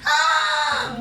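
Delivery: demonstrated scream of fear; mock scream. Shown as a demonstration. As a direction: act out a frightened yell. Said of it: "como empezar a gritar".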